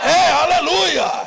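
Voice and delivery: loud, high, drawn-out praise shout; shouted hallelujah. Real, not acted. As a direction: cry it out to rouse the congregation. Preacher shouts hallelujah to congregation. (aleluia gritado com fervor)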